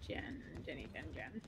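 whispered, under breath; whispering. Real, not acted. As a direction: breathe the names softly. Muttering during game.

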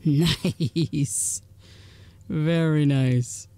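slow, stretched very nice; drawn-out praise. Real, not acted. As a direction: savour each word, slowly. Player praising a good hit.

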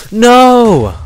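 sudden high scream of shock; startled shout. Real, not acted. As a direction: yell in real fright. Creeper explodes, real shout.

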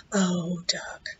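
breathy whisper of pity. Acted. Mouse sees her hurt friend, whispering. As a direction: whisper the name with sympathy.

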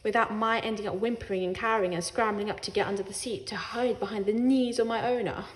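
ordinary delivery

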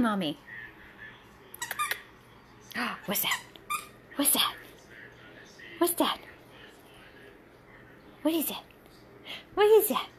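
high, curious cooing questions; playful pet talk. Real, not acted. Owner watching her dog go after toys, curious baby talk.

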